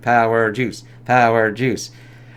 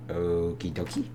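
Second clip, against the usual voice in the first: lower, much softer throwaway okie dokie; mock okie dokie; 7 semitones lower, 11 dB quieter, slower than usual. Viewer mocking the hero's reaction, low mumble. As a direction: mumble it with a shrug. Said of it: "It's like".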